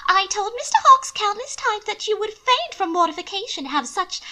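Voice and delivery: high, breathless genteel lady; high nervous young lady explaining herself; Mina pleading. A narrator's reading. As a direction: fuss anxiously in a high voice. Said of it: "Mina carried on".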